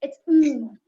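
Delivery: ng pressed out loud and nasal; the ng in "It's ng." with no vowel before it; nasal ng demo. Shown as a demonstration. Teacher insisting on the correct ng. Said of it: "It's never a ing".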